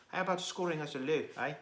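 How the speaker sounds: put-on quick, lightly done South African accent; mock South African accent. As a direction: toss off a playful South African accent impression. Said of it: "he does a pretty decent South African accent".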